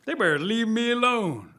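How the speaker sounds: loud, high defiant protest; defiant turtle. Acted. Turtle declaring he won't take this anymore, defiant. Turtle boasts and demands peace.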